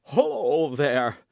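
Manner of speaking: startled lackey's slow drawn-out cry; a servant calling out, slow and alarmed; Grimaud crying out. A narrator's reading. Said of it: "cried he".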